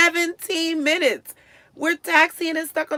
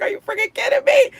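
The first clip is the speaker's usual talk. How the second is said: high, loud outraged disbelief; retold shout of disbelief at the fare; loud mock outrage; 11 semitones higher, 5 dB louder than usual.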